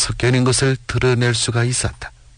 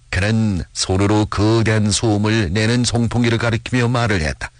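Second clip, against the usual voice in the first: usual speaking voice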